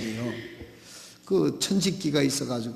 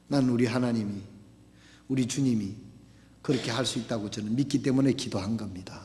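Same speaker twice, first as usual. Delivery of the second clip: ordinary talk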